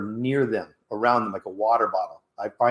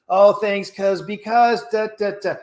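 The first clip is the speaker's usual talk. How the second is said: high, louder whiny excuse-making; mocking excuses; 7 semitones higher, 4 dB louder, slower than usual. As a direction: mimic someone fumbling for excuses.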